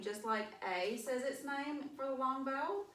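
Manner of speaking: usual speaking voice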